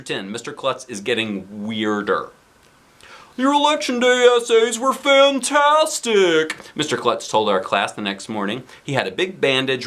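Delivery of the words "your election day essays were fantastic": high, loud, slow principal voice. Acted. Principal praises the class in a hammy voice. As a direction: boom it out like a cheery principal.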